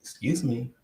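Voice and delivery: ordinary talk, nothing marked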